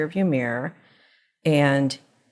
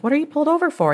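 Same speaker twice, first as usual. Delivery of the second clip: higher-pitched retold query; puzzled questioning; 11 semitones higher, 3 dB louder, much faster than usual. Mother asking son why he pulled over, puzzled. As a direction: ask it high, puzzled at a stopped driver.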